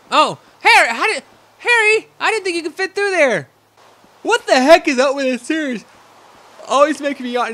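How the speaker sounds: loud, high, amazed exclamations; excited surprise. Real, not acted.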